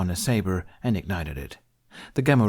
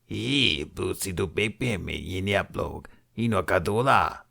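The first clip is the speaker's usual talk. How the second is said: Hutt voice. Acted. Hutt speaks Huttese.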